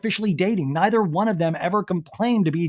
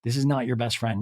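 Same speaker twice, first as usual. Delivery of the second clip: gently acted soothing, lowered voice; imitated comforting; 5 semitones lower, 4 dB quieter than usual. Venita hugging upset Olivia, imitated soothing. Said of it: "Venita hugs her and goes".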